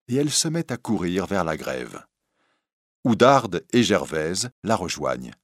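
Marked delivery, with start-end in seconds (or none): none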